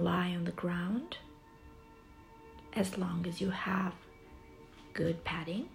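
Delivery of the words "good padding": normal talking voice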